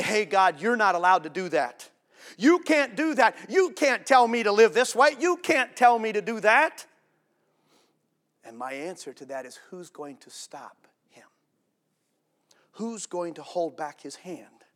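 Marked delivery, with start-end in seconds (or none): 0.0-6.9 loud, high, indignant protest; defiant shout at God. Retold or imitated. Congregation voiced mocking God. 9.7-11.3 hushed, slow whisper. Real, not acted. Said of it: "whispered rhetorical question".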